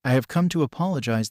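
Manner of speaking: ordinary delivery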